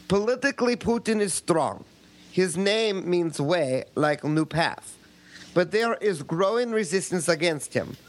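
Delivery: put-on Russian accent reading a listener email; a light Russian accent; mock Russian accent. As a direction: read it as a Russian would, hammed-up. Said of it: "You can drop the accent now".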